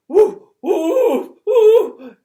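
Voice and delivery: put-on loud high baby bellow "Ooh"; bellowing howl. Baby Morgan bellowing at the crowd, loud and high. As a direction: bellow like a wailing toddler. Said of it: "Morgan bellowed".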